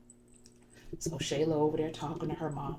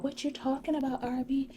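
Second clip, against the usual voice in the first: very high mock-clueless voice; feigned innocence; 12 semitones higher than usual. Busted Shayla denying everything to Arby, high mock innocence. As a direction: imitate her playing dumb, pitched way up. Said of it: "Shayla gets this dumb look on her face".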